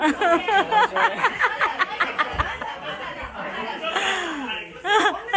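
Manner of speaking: high, loud cackling laugh; loud laughter. Real, not acted. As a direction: burst out laughing, long and high. Laughing with the group.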